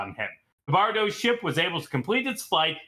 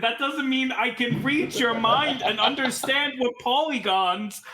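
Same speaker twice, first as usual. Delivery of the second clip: indignant Cecil; 8 semitones higher than usual. Acted. NPC insists, much higher.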